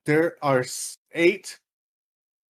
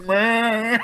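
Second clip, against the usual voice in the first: higher, drawn-out bleat; imitated dancer noise; 8 semitones higher than usual. Teller demonstrating the dancers' noise, silly bleat. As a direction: bleat it out for laughs. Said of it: "That's the noise they make".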